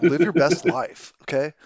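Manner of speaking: lightly voiced mellow surfer; surfer dude. GM tries his inner Californian on the players, lightly surfer. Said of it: "go full surfer".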